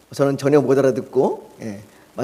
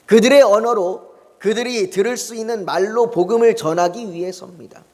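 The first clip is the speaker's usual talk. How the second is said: sermon building from earnest to raised, high pleading; preaching; 4 semitones higher than usual. Acted. Pastor urging the congregation toward the gospel, rising preaching voice. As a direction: press the point home to the congregation.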